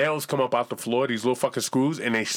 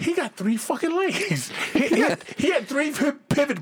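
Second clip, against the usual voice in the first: excited punchline; 10 semitones higher than usual. Real, not acted.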